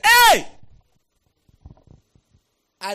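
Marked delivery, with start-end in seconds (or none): 0.1-0.5 high, loud cry of sudden realisation; sudden high yell; startled shout. Retold or imitated. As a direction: shout it out as if jolted by a revelation. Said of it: "And you're like".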